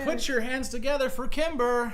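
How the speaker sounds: high, loud emcee introduction; big stage introduction; host announcement. Acted. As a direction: call for applause like an emcee. Host announces a new arrival.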